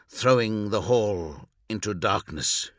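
plain reading voice

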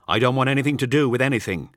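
a farmer losing patience with his guest; angry outburst. Acted. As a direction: throw it at him to end the talk. Farmer angrily orders visitor out.

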